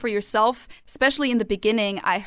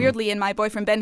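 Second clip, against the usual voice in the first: usual speaking voice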